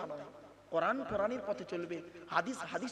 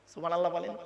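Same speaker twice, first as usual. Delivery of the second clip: ordinary talk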